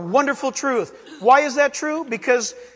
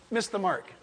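ordinary talk, nothing marked

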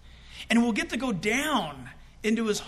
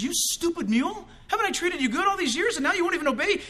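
higher-pitched scolding of a stubborn mule; mock-angry master berating his donkey; angry rider; 8 semitones higher, faster than usual. Balaam yells at his donkey, higher angry scold.

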